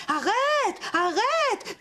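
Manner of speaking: high, calling after him; farmer shouting stop. Acted. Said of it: "en criant".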